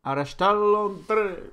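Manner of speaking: hammed-up Basque accent, drawn out; mauvais accent basque. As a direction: fake Basque accent, slow and loud. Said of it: "avec mon accent basque dégueulasse". (accent basque exagéré et lent)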